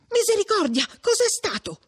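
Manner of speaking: high-pitched startled exclamation; alarmed cry. A narrator's reading. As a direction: cry out in alarm at a sudden yell. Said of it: "gridò Perpetua".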